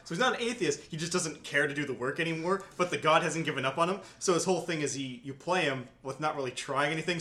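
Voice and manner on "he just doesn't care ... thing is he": ordinary talk, nothing marked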